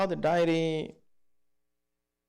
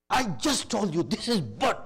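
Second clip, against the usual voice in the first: annoyed son; 7 semitones higher, 4 dB louder than usual. Retold or imitated. Son irritated at repeated question.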